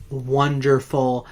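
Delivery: usual speaking voice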